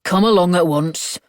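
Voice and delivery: lightly voiced officer, brusque and loud; stern officer. A narrator's reading. Officer ordering the Italian man away, firm.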